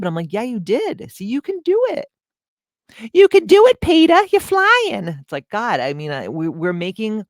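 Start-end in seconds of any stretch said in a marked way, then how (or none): 0.3-5.1 put-on high, loud, sing-song cheering like to a child; hammed-up pep talk, pitched way up and loud; mock cheer. Wife gushing over her husband's cooking, high hammed-up cheer.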